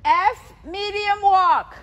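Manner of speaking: loud, projected movement call. Real, not acted. Caller to rider on trot and walk, calling out. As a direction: send each call across the arena.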